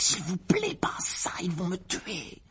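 raspy, breathless pleading whisper; hoarse whisper. Acted. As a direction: beg in a rough whisper. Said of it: "d'une voix rauque".

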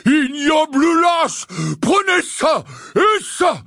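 slow, loud, furious bellow; howled. A narrator's reading. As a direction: roar while landing blows. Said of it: "hurla Hagrid".

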